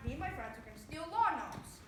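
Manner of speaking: ordinary delivery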